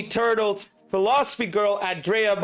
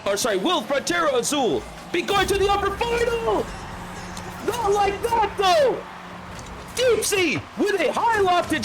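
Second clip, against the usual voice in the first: high, fast-rising hype call of a late goal; live play-by-play; 9 semitones higher than usual. Acted. Caster calling the final seconds to the stream, hyped. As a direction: ride the action and build to a roar on the winner.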